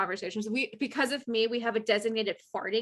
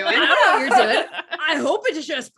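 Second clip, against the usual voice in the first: loud, fast, laughing I don't know what you're doing; laughing tease; 3 semitones higher, 7 dB louder than usual. Retold or imitated. Teasing a coworker about ten minutes in the bathroom, laughing loudly.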